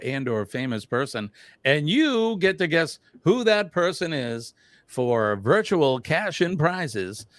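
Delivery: booming high announcer hype; game show announcer voice. Pretend. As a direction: sell it like a TV game show host. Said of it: "my game show announcer voice".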